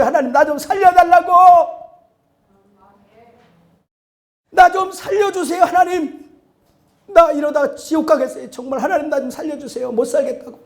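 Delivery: crying out high and loud to God; desperate prayer. Acted. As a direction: beg for your life, shouting. Acted desperate prayer in car.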